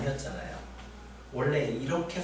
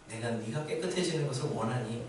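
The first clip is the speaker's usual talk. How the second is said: soft quoted question; 8 dB quieter than usual. Retold or imitated. Jesus asking the unclean man as he touches him, quoted softly. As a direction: quote it softly, well under preaching level. Quoting Jesus speaking to the leper.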